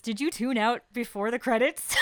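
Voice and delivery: giggling disbelief at a friend; laughing question. Real, not acted. Teasing co-host who missed scene.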